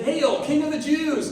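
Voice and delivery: mocking hail. Soldiers mocking Jesus as king, loud sneering hail. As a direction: jeer it like a cruel soldier.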